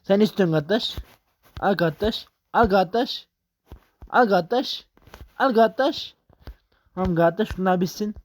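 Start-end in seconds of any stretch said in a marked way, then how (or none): 0.0-6.1 calls climb higher and more insistent; pushing the item at the customer, pitch building; rising excitement. Real, not acted. Gamer hands order to customer, rising pitch.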